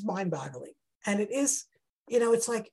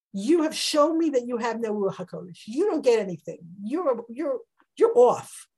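louder, cutting rebuke; harsh rebuke; 3 semitones higher, 6 dB louder than usual. Acted. Hannah rebukes Eli for lacking insight, harsh. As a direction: put the priest in his place. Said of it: "she was harsh with him".

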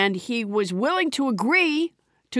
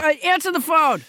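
insistent; 7 semitones higher than usual. Real, not acted. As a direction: press him to answer. Insists he answer the phone.

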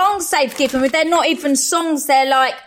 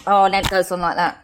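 ordinary talk, nothing marked

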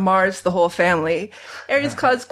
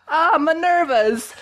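put-on terrible Italian accent; 7 semitones higher than usual. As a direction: ham up a bad Italian accent. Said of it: "in a terrible Italian accent".

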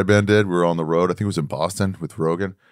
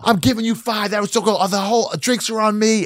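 much higher, hammed-up squeal of joy; overjoyed winner; 11 semitones higher than usual. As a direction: play the giddy lottery winner. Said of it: "And he goes".

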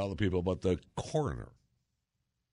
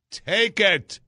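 1 exasperated outburst; 11 semitones higher, 10 dB louder than usual. Real, not acted.

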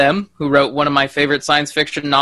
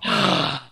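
theatrical sharp gasp; mock shocked gasp; 7 dB quieter than usual. Host feigns horror at a friend's differing opinion, shocked gasp.

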